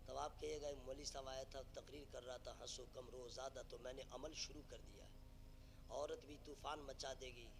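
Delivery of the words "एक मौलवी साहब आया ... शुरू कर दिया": low, soft explanation; subdued, lightly acted confession; meek husband. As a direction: play a husband quietly accounting for his crying. Acts tearful husband explaining himself.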